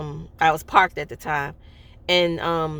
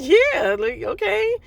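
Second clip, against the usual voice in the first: high, dazed agreement; stunned okay; 12 semitones higher, 3 dB louder, slower than usual. Retold or imitated. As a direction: still reeling, squeak it out. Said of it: "And I was like".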